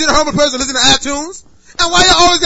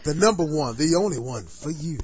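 sultry voice; 12 semitones lower, 12 dB quieter than usual. Pretend. Sultry voice continuing joke.

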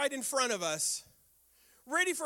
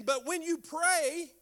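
slow quote; 5 semitones higher, slower than usual. Shown as a demonstration. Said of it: "he reiterates it again".

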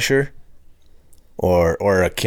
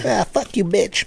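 higher, lightly put-on retort; mock comeback; 11 semitones higher, slower than usual. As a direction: toss back a hammed-up insult. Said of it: "And then she goes".

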